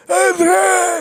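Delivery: very high, loud strained cry; mock bellow of a movie boxer calling his love; Rocky. Mocking co-host's idea of Stallone, high hammed-up shout. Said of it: "that's not Sylvester Stallone's voice. He doesn't go".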